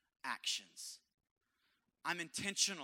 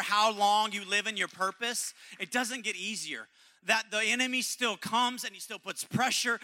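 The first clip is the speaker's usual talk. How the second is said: rising, pressing preacher's build; building sermon; 5 semitones higher, 8 dB louder than usual. Acted. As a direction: drive it harder line by line.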